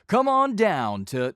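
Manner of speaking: booming, slow, high announcer voice; big projected announcer shout; old-school announcer read. Shown as a demonstration. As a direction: sell it like a game show announcer. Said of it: "that's an announcer read".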